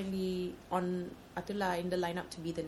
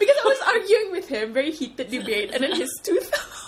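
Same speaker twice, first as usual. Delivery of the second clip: much higher and louder, laughing through it; 12 semitones higher, 10 dB louder than usual. Real, not acted. Laughing as she tells friends the tooth fell off. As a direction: gasp out the punchline laughing.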